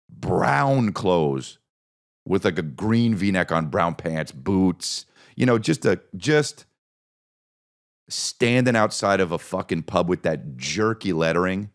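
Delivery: ordinary talk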